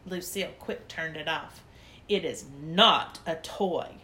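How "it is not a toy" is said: slow, lower, clipped grumble; grouchy. Acted. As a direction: scold them for playing with it. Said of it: "she grouched".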